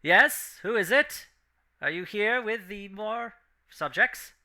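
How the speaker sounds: high, loud voice through a metal grill; harsh metallic voice. Acted. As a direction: speak like a harsh intercom. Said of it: "a harsh metallic voice".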